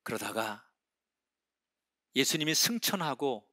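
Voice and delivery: ordinary talk, nothing marked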